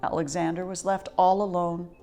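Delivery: usual speaking voice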